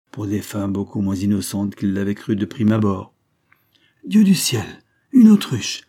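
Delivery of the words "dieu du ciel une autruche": cri de stupeur très aigu et fort; n'en pas croire ses yeux, s'écrier; stupeur bégayante. A narrator's reading. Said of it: "bégayait le substitut". (le substitut stupéfait devant l'autruche, cri aigu)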